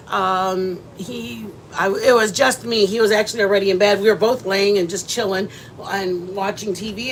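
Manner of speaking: ordinary talk, nothing marked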